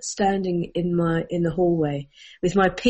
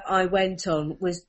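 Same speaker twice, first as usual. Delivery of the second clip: ordinary talk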